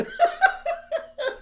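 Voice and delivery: shrieking high laugh, gasping; high helpless laugh. Real, not acted. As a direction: laugh until it squeaks.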